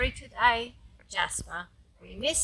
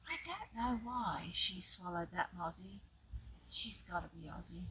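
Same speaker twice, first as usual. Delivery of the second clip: soft storytime voice, sinking quieter; hushed refrain; 3 semitones lower, 13 dB quieter than usual. A narrator's reading. Grandma reading the refrain before the big finish, hushed. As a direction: let the refrain drop away softly.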